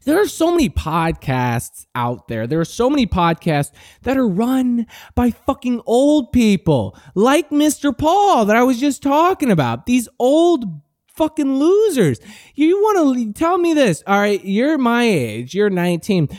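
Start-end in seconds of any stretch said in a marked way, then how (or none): none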